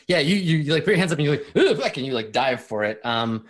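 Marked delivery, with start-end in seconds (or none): 1.6-2.0 high, sharp startled yelp. Acted. Player's character dives for cover, startled high yelp. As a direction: yelp it as bullets start flying.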